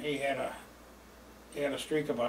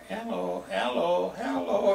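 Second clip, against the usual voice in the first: mock echo; 6 semitones higher, much slower than usual. Caddies in the trees echoing hello across the fairway, rising. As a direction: imitate the kids echoing from the trees. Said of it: "they could hear".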